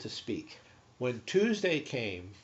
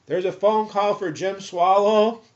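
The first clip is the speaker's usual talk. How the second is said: loud, higher announcement down the hall; calling out; 7 semitones higher, 9 dB louder than usual. Retold or imitated. Staff summoning patient to the phone, calling out. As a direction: call out for someone across a ward.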